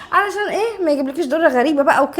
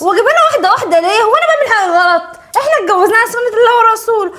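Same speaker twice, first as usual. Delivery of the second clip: high, loud, fast defiant retort; defiant protest; 6 semitones higher, 4 dB louder, faster than usual. Acted. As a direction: push back hard at him. Wife argues back at husband. (اعتراض عالٍ وعنيد)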